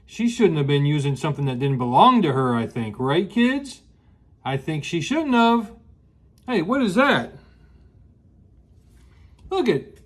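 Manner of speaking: plain reading voice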